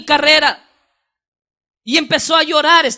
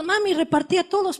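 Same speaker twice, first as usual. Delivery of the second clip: put-on high, simple child's voice; young son's voice; 5 semitones higher, 6 dB quieter than usual. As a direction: answer like an innocent boy. Child answers his mother.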